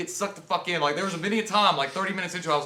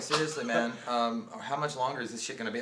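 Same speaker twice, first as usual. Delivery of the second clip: bored actress; 6 semitones lower, 7 dB quieter than usual. Retold or imitated. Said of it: "she doesn't look bored to even be there".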